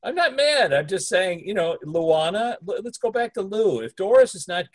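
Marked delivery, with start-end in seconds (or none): 0.0-0.7 high protesting denial; defensive protest. Real, not acted. Co-host denying anger to partner, high protest. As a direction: insist you're not mad, way up high. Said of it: "you get really mad".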